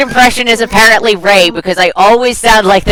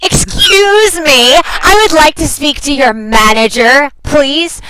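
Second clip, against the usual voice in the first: slow, higher-pitched indignant customer; Karen impression; 5 semitones higher, slower than usual. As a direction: draw out each demand like an outraged customer. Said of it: "My mom's name's Karen, can you tell?".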